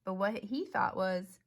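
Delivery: ordinary delivery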